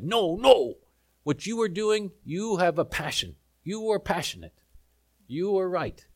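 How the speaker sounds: lightly voiced Argentinian man speaking broken English. To the preacher after the game, a light Argentinian broken-English voice. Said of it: "in his broken English".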